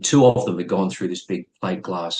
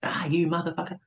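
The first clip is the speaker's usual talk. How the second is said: mock scream; 9 semitones higher, 4 dB quieter than usual. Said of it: "Frank starts to scream".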